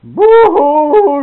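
high, very loud drawn-out wail; mock bellowed crying. Refused daughter bawls to her father, mock bellowing. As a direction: imitate a girl bawling at full volume. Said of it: "boy, she bellered".

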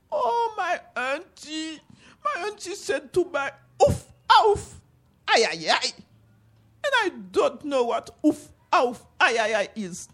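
put-on high, tearful little boy voice; crying boy. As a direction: whimper it like a small crying child. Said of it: "the little boy was crying".

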